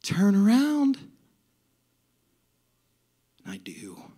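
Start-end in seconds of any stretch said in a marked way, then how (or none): 0.0-1.0 higher, louder singsong call; joyful attendant's rising lilt; happiest woman. Retold or imitated. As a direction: sweetly sing out the instruction. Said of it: "And she says".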